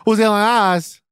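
loud sharp voice. Shown as a demonstration. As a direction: yell it up high and sharp. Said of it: "why are you yelling".

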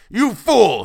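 furious high scream. A narrator's reading. Tussman raging at his servant, screaming. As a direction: scream it at him, full force. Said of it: "he screamed".

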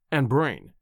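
ordinary delivery, nothing marked

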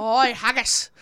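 mock Scottish exclamation; hammed-up Scottish accent. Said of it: "that's Scottish".

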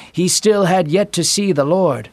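usual speaking voice

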